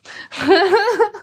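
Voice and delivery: high bright laugh bursting out; burst of laughter. Real, not acted. Streamer laughs at her own heaven joke, high laugh.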